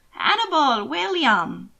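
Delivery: a bright, lightly voiced old aunt calling out; trilling call. A narrator's reading. Aunt Margaret hailing the two young men, trilling call. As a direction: sing their names out like a trill. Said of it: "she trilled".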